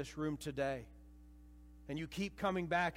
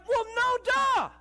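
loud, much higher exasperated shout; exasperated Jesus; 11 semitones higher, 7 dB louder than usual. Retold or imitated. Jesus scolding the doubting disciples, loud exasperated shout. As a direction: scold them like they should have known. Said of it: "And he's like".

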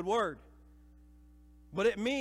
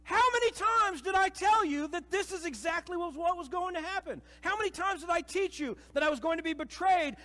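loud, much higher exasperated shout; exasperated Jesus; 11 semitones higher, 7 dB louder than usual. Retold or imitated. Jesus scolding the doubting disciples, loud exasperated shout. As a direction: scold them like they should have known. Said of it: "And he's like".

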